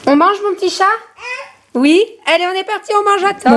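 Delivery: sing-song call to come eat. Real, not acted. Calling toddler to dinner.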